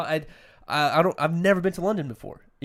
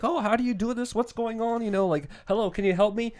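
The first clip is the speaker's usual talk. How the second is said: higher, clueless mock customer voice; 8 semitones higher than usual. Imitating a typical American customer at the airport, higher and clueless. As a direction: play a confused helpless customer. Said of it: "to be a little bit like".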